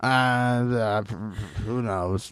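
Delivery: low, halting imitation of a drunk guy; mock drunk rambling. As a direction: drop way down and stumble through it like he's tipsy. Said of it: "It was like".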